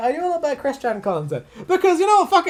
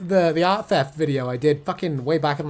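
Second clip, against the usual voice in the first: normal talking voice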